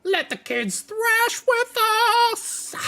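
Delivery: very high, loud screech; Squeal's squeal. Acted. Squeal cuts into the council, very high, loud screech. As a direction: shriek it like a rock goblin. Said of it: "Squeal then juts in".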